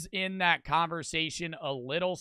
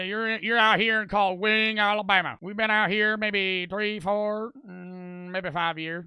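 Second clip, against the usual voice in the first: put-on Alabama accent; 3 semitones higher than usual. Streamer playing a Wing local, mimicked Alabama accent. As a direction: imitate a backwoods Alabama accent. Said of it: "I wish I had a cool ass accent".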